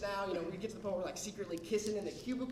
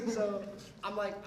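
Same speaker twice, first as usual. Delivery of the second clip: ordinary delivery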